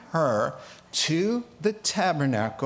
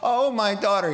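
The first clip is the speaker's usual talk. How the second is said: anguished father; 6 semitones higher, 4 dB louder than usual. Retold or imitated. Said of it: "he says".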